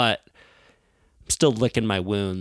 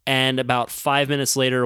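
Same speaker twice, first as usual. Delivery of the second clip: ordinary talk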